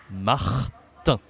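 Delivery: "Martin" split into two drawn-out syllables; "Martin" syllable by syllable, final n silent; nasal French ending. Shown as a demonstration. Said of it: "a nasal".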